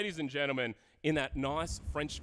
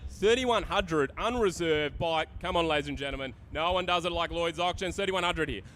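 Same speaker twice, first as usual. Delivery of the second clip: rapid rolling auction call; auctioneer calling; 6 semitones higher, 6 dB louder than usual. Acted. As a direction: rattle off the bids fast. Live auction chant.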